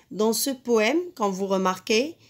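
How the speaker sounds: ordinary talk, nothing marked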